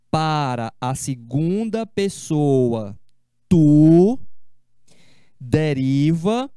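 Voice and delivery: slow, deliberate explanation; patient slow recap for students; calmly repeated. Shown as a demonstration. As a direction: lay it out slowly, word by word. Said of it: "Vou repetir com calma".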